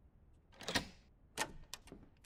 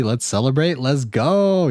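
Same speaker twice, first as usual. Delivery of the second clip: usual speaking voice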